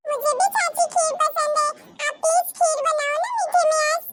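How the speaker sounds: put-on high, eager little girl's voice; child voice. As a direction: play a small child pleading sweetly. Child asks aunt for kheer. (ऊँची आवाज़ में छोटी बच्ची)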